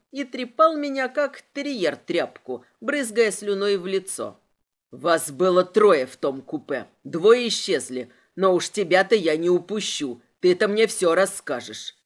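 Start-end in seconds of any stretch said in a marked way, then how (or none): 4.9-12.0 low, forceful interrogation; menacing low-pitched inspector; angry threat. A narrator's reading. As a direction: press her low and hard.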